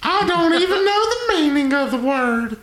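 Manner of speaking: high squeaky indignant mayor; high pitched, mock offended; mayor character voice.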